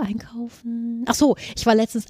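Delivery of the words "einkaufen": geflüstert, langsam, nur für sich; whispered reminder. Real, not acted. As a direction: breathe the word out slowly as it comes back to you. Sudden reminder to herself. (leise geflüstert, wie ein plötzlicher Einfall)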